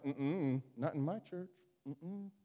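soft, tight-lipped refusal; muttered disapproval. Retold or imitated. Preacher imitating upset priests watching the kids, muttered disapproval. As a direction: grumble it low to yourself, imitating a disapprover. Acting disapproving leaders at noisy children.

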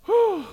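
a high, loud, breathy whew of relief; relieved whew. Real, not acted. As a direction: blow out a hot, flustered whew. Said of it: "Now I'm sweating".

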